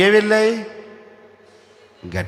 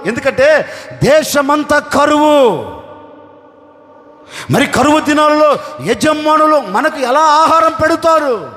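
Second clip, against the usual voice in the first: louder, raised sermon voice; preaching at full force; 5 semitones higher, 4 dB louder than usual. Acted. Oxen pitying their starving masters, preached out loud. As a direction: drive it home to the congregation.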